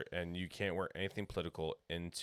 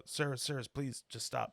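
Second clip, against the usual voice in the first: higher-pitched intervening poll worker; imitated woman calming a row, higher; retold pleading lady; 6 semitones higher, slower than usual. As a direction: plead for him to stop. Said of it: "the lady goes".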